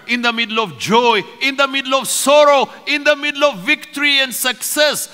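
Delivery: high, forceful preacher's voice; impassioned preaching. Real, not acted. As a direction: build in driving, repeated phrases to the congregation.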